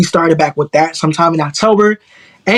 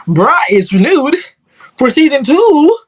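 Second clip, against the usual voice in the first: excited announcement; 6 semitones higher than usual. Real, not acted. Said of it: "I'm happy to say".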